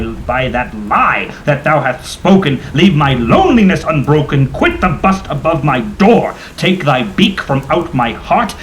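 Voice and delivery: loud, driven commands, slowing on the last lines; furious shouting. Acted. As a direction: drive the bird out with your voice. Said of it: "I shrieked upstarting".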